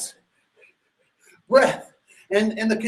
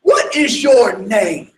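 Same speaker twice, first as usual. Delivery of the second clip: slow, deliberate demand from the overseer; a hint of a harsh overseer; demanding his name; stern demand; 5 dB louder, slower than usual. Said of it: "the conditioner says".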